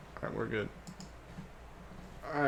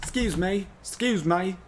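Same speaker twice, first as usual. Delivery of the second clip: high, raised character voice; pitched-up rehearsal take; character voice practice; 7 semitones higher, 3 dB louder than usual. Shown as a demonstration. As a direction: try out the character's voice.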